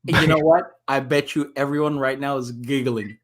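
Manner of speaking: usual speaking voice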